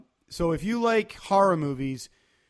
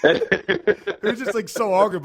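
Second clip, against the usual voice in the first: real laugh breaking through, louder and slow; 4 semitones higher, 7 dB louder, slower than usual. Real, not acted. To his co-host about the porta-john moment, laughing. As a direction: laugh out the words as the memory hits. Laughing while retelling awkward story.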